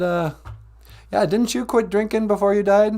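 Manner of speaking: normal talking voice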